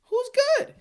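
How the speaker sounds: high, mocking squeal with a laugh; mocking whiny fan. Mimicking a clueless fan who asks who's good. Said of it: "then be like".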